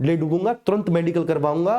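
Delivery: ordinary talk, nothing marked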